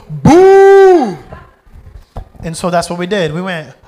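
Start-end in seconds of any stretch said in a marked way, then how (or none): 0.1-1.2 high, loud drawn-out boo; rehearsed jeer, shouted high. Retold or imitated. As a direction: heckle at full volume. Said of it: "we're gonna go".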